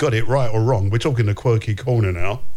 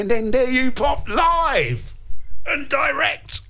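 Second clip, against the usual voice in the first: excited exclamation; 11 semitones higher, slower than usual. Real, not acted. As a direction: cheer that he turned up live. Surprised he went on air live.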